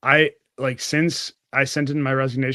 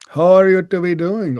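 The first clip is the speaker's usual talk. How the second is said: higher and louder, mock Indian English boss greeting; imitated Indian accent; 6 semitones higher, 8 dB louder than usual. Boss opening the call, imitated Indian accent. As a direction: put on the boss's Indian accent, cheery. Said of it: "I know you're Indian".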